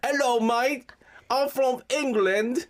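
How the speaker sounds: high, slow, hammed-up British accent; terrible British accent. Host proves his bad accent, high mock British. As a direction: badly fake an English greeting. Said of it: "your British accent was terrible".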